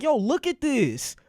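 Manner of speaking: high, drawn-out coaxing; eager urging. Retold or imitated. Urging his sisters toward the screen, eagerly. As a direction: stretch it out to wave them over.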